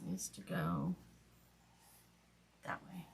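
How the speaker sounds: muttering to herself. Real, not acted. Muttering to herself while working.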